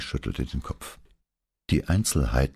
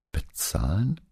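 raised, questioning repeat of BEZAHLEN; surprised echo question; 6 semitones higher, slower than usual. A narrator's reading. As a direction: echo "Bezahlen" with a rising, startled question tune. Paola reacts to shocking news. (überrascht nachgefragt)

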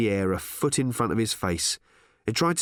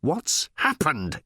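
slow, emphatic, higher-pitched demand; insistent; 5 semitones higher, much slower than usual. A narrator's reading. Ford cutting through the robot's gushing, insistent. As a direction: bear down on each word. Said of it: "insisted Ford".

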